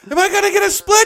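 very high and loud eager plea; excited high voice. Retold or imitated. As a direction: beg it like a giddy fan. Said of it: "He's like".

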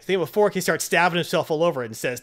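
ordinary talk, nothing marked

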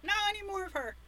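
high whiny protest; whiny plea. Retold or imitated. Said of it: "I was like".

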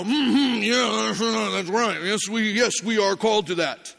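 lightly voiced churchgoers agreeing; nodding congregation. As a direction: murmur along as a congregation would. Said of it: "collectively around the room, we all go".

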